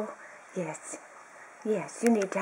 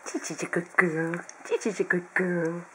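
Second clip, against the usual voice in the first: ordinary talk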